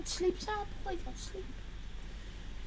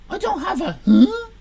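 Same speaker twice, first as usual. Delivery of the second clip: normal talking voice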